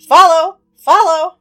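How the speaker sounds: very loud, high squawk; high, piercing bird call; ghost bird cry. Acted. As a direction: shriek the command like a bird. Bird calls out to the party.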